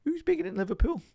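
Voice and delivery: high, exaggerated Scouse accent. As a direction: ham up a thick Scouse accent, pitched high. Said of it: "in the most Scouse accent".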